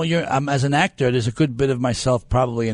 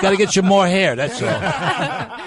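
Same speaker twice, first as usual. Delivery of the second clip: higher, laughing jab; laughing tease; 6 semitones higher, slower than usual. Real, not acted. Ribbing Chuck about his hair, laughing. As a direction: tease him through a laugh.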